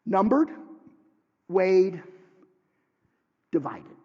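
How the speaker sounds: "Numbered" and "weighed" struck loud, each word alone; hammered verdicts. Acted. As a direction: pound out each verdict like a gavel. Preacher hammers the three verdicts.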